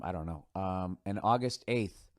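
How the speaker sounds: ordinary delivery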